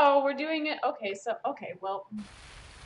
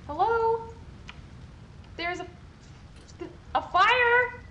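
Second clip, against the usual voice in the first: high, loud rising panic; panicking; 11 semitones higher, 4 dB louder than usual. Real, not acted. Her prisoners won't move in the fire, real panic. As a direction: voice climbs higher with each question.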